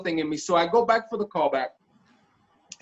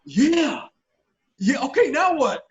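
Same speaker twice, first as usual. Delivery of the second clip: amped; 8 semitones higher, 4 dB louder, slower than usual. Retold or imitated. Hyped after the audition.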